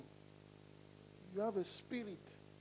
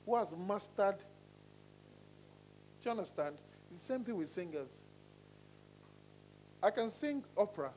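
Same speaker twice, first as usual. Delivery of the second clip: normal talking voice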